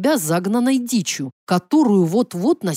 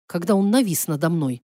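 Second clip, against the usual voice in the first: usual speaking voice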